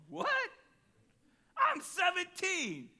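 very high, loud and slowed disbelief; shocked teen squeal. Retold or imitated. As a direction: squeak it out, can't believe this is happening. Teen shocked at bend over.

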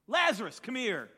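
loud commanding call. Retold or imitated. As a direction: summon him from the tomb. Jesus calls Lazarus out.

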